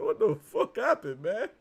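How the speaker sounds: slow, loud, bewildered; dazed movie character. Retold or imitated. Bernie Mac waking up confused, loud and slow. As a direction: drag it out, stunned after being knocked out. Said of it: "he said".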